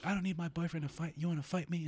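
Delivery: high-pitched imitation of a defiant woman; hammed-up high voice; mock challenge. Imitating neighbour girl offering a fight.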